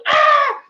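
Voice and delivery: short, piercing, very high shriek; mock scared scream. Speaker mimics the crowd seeing King Kong, terrified scream. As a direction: shriek like a frightened audience. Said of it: "they're scared. They're like".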